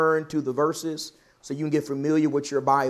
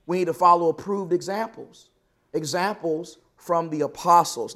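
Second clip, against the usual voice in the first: ordinary delivery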